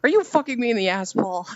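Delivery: high, rapid mock-outraged catchphrase; Sis Eldon. Quoting a friend's catchphrase for laughs, high and fast. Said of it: "I hear it in Sis Eldon's voice".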